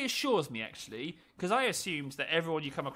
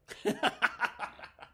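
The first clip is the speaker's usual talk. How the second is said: amused laughter; 6 semitones higher than usual. Real, not acted. Laughing at the opponent.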